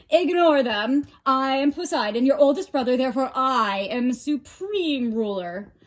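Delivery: loud proud boast; boastful brother. A narrator's reading. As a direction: claim the throne loudly.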